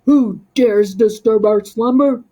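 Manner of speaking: very loud booming creature voice. Acted. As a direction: boom from the dark to scare intruders. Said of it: "boomed a deep voice".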